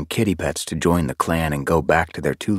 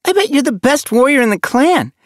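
high, loud, gushing praise; starstruck youngster; 7 dB louder than usual. A narrator's reading. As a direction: gush like an adoring little kid. Apprentice hero-worships new warrior.

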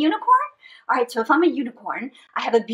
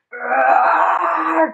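loud, drawn-out pretend dinosaur roar; T-Rex roar; 4 dB louder than usual. Storyteller playing dinosaur with the kids, T-Rex roar.

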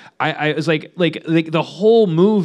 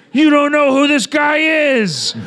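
higher, louder hammed-up warning; mock shouting boss; 11 semitones higher, 5 dB louder, slower than usual. Greek boss warns about John Wick, hammed-up shout. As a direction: yell the warning.

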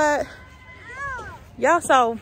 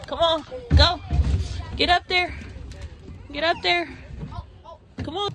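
encouraging; 6 semitones higher, 5 dB quieter than usual. Real, not acted. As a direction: urge the little one on, pitched up. Cheering kid up slide.

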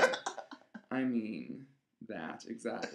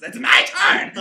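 much higher, very loud scream; furious scream; 12 semitones higher, 20 dB louder, much slower than usual. Acted. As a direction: scream it in mom's face. Daughter screams at mother.

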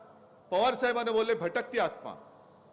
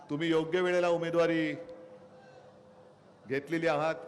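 rally speech; 5 semitones lower than usual. Acted. Political rally speech opening.

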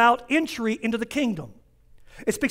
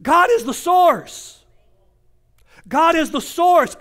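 higher, slow, each word landed; emphatic declaration; 4 semitones higher, 3 dB louder, slower than usual. Acted. Preacher declaring God the source to the church, emphatic. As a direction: proclaim it.

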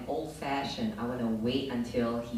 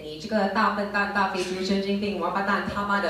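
higher, louder Mandarin, speeding at the end; Mandarin insults; 5 semitones higher, 4 dB louder than usual. Retold or imitated. She insults the French guy in fast Mandarin. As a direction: rattle off Mandarin insults sweetly. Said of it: "And I said".

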